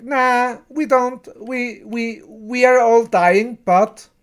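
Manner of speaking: retelling the aliens refusing to help, lightly voiced; mock alien voice.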